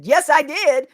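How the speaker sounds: high, loud, emphatic yes; triumphant reply. Retold or imitated. Retelling her answer to her husband, triumphant. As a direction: announce it proudly, pitch lifted high.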